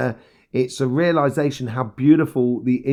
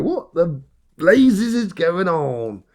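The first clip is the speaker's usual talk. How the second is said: slow, raised, hammed-up American accent; mock American accent, slow and higher; 4 semitones higher, much slower than usual. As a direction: drawl it out in a bad American accent. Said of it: "that's my American accent".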